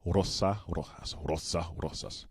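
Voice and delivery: careful attempt at Spanish words; imitated Spanish pronunciation. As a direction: echo the Spanish hesitantly, word by word. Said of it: "that was not in English".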